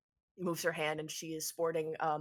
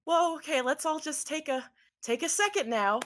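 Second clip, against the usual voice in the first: higher, soothing de-escalation; hands-up calming; 9 semitones higher than usual. Acted. Dandy calming Atticus holding a knife, lightly voiced soothing. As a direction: talk them down gently.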